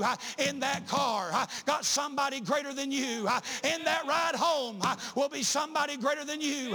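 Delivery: rising, fervent sermon delivery; preaching. Acted. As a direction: build the congregation up toward the climax. Preacher building the Elijah sermon.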